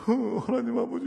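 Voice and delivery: mock anguished moan, high; dying groan. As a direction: whine it like a dying man repenting. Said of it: "막 죽는 소리를 하는 거야". (죽어가는 듯한 흉내)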